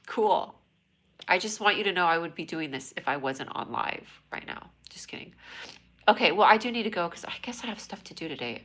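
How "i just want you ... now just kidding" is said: unvoiced confiding whisper. Real, not acted. Confiding aside.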